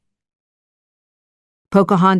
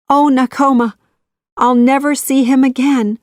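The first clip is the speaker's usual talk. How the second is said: grieving; 7 semitones higher, 4 dB louder than usual. A narrator's reading. Grieving girl to friend.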